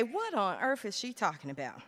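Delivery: low, hushed mock-audience question; puzzled aside. Speaker imagines listeners wondering what she means, puzzled aside. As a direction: drop it to a baffled undertone.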